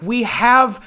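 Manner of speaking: loud, slow firm guard voice; stern security guard. Retold or imitated. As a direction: lay down the law slowly. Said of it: "the security was like".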